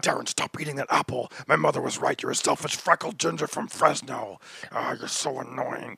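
a hint of a menacing wife nagging; demonic wife voice. Comic voices his nagging wife as faintly demonic. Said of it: "make her sound almost, like, demonic".

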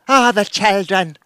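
slow drawn-out Irish lament; Kelly MacDonald impression. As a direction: mourn it like a Boardwalk Empire widow. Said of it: "Kelly MacDonald in Boardwalk Empire".

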